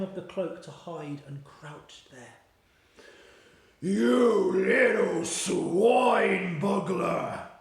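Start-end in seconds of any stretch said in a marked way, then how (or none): 3.8-7.5 very slow, louder roar; roaring giant. Acted. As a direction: bellow the insult with fury. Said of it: "roared the Blood Butler".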